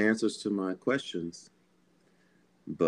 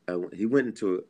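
ordinary talk, nothing marked

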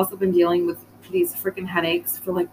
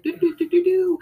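mock babble; 10 semitones higher than usual. Imitating people's chatter.

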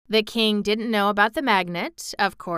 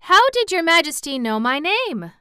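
high, louder surprised question; 10 semitones higher, 7 dB louder than usual. A narrator's reading. Dorothy asks how the king knows her, surprised. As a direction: ask in raised-pitch surprise. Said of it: "This speech greatly surprised the little girl".

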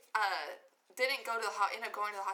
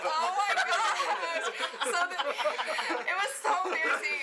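embarrassed laughing; 9 semitones higher, 6 dB louder than usual. Real, not acted. Embarrassed, retelling her fainting.

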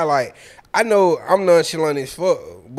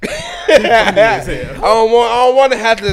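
loud, higher, starting on a laugh; exasperated protest; 4 semitones higher, 6 dB louder than usual. Real, not acted. Host refusing to explain his needs, loud laughing exasperation. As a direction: protest loudly through amusement.

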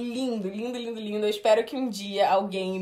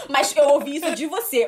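normal talking voice

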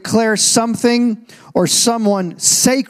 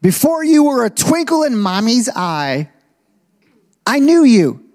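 rising proclamation; 5 semitones higher than usual. Acted. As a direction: proclaim it like a promise from God. Preacher proclaiming God's words.

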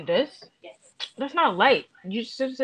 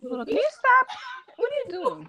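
imitating him; 5 dB louder than usual. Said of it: "He used to be like".

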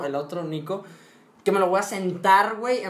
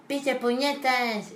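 a higher, slow, dragged-out mock shout; mock kid yell; 6 semitones higher, much slower than usual. Little boy copying his dad in the stands, a higher mock shout. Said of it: "yo gritaba de que".

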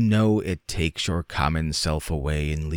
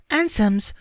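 put-on much higher, louder gleeful boy; exultant child; 5 dB louder than usual. A narrator's reading. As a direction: crow it like a delighted child. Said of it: "in a note of culminating exultation".

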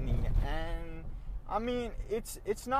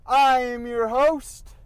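loud announcer intro; 7 semitones higher, 12 dB louder, slower than usual. Acted. Host announces himself loudly.

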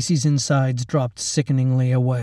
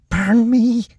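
much higher, louder desperate hiss; hissed plea; 10 semitones higher, 5 dB louder than usual. A narrator's reading. Lacey begging the orderly to burn her, hissing. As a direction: beg through clenched teeth. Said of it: "she hissed".

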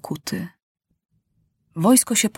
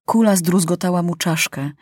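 plain reading voice

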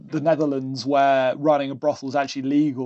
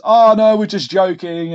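louder, higher gotcha laugh-off; imitating a driver's breezy loud gotcha; mock cheerful reveal; 4 semitones higher, 8 dB louder than usual. Driver telling scared tourists it was a joke, mock breezy and loud.

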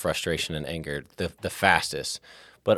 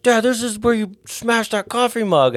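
much higher, childlike imitation of his kids; kids' voice; 6 dB louder than usual. Father imitates his kids reminding him of the mug, high child voice. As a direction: mimic a kid teasing dad. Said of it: "They're like".

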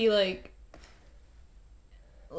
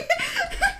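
high burst of laughter; 4 dB quieter than usual. Real, not acted. Laughing after screamed quote.

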